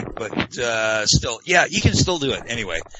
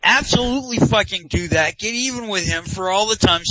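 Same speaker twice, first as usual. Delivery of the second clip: excited; 8 semitones higher than usual. Real, not acted. Urging cohost to spam picks.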